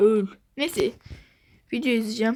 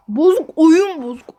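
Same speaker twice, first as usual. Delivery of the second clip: loud complaint; 4 semitones higher, 8 dB louder than usual. Real, not acted. Angry at broken game.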